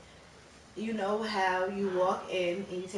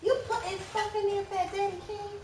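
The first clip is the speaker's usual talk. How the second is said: much higher cooing voice; 11 semitones higher than usual. Real, not acted. Baby talk to child.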